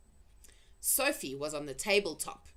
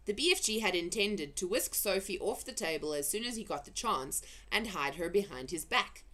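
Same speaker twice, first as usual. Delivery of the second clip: usual speaking voice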